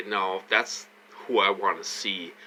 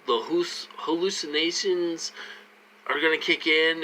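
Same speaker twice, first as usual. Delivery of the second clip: higher mock voice; imitating his tormentors taunting him, pitched up; sneering parody of the people he blames; mocking them; 8 semitones higher than usual. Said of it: "they're like".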